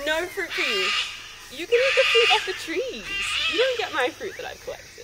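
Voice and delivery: high-pitched mock telling-off; playful pet scolding. Real, not acted. Playfully scolding the animals begging fruit.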